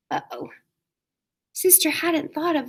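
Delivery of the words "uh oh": soft worried whisper. A narrator's reading. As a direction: whisper it like a small worry. Realizing greed warning.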